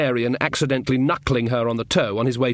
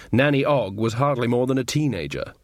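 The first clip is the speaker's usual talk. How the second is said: plain reading voice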